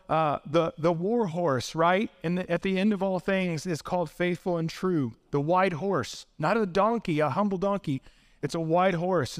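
ordinary delivery

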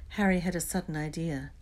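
ordinary delivery, nothing marked

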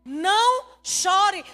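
slow, loud command. Acted. Jesus to the weeping woman, commanding. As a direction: draw it out as a forceful order. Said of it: "coisa que Jesus disse".

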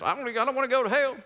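high, quick comic whine; mock frightened believer. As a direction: imitate a panicky churchgoer in a high, hurried voice. Mimics fearful fire-insurance believer, drawled hell.

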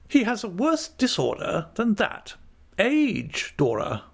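high, knowing character voice; kindly aunt. A narrator's reading. Aunt telling Dora what ails the old dog, kindly. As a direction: break it to her from high up, falling on the name.

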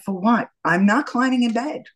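defiant refusal. Retold or imitated. Rejects husband's suggestion to stay home.

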